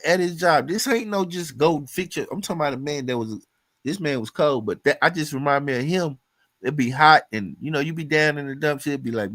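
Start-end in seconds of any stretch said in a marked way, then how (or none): none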